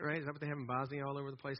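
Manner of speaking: ordinary talk, nothing marked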